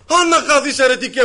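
loud, high furious curse; angry shout. Retold or imitated. Old man in the bakery curses the narrator, furious shout. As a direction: bark it at the heretic. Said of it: "μου λέει".

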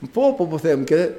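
ordinary delivery

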